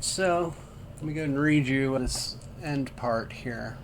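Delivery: normal talking voice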